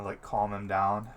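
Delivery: normal talking voice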